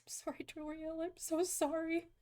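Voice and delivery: soft, high, remorseful murmur; hushed apology. Real, not acted. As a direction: apologise softly to the character. Apologising to game character, near whisper.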